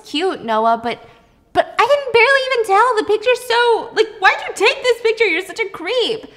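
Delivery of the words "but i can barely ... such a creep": excited gushing. Retold or imitated. Noah gushing over the photo.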